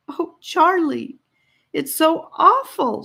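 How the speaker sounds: high, slow and pained, near tears; a young girl aching with pity; distressed girl. Acted. Annika distressed over blinded Charlie.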